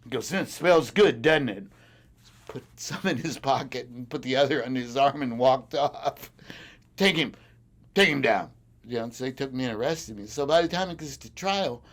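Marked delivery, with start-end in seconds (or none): 0.3-1.6 higher, louder taunting question; louder, smug cop tease; mock cop taunt. Cop taunting him over the weed. 7.0-8.5 louder, higher barked command; loud cop command to arrest him; mock cop order.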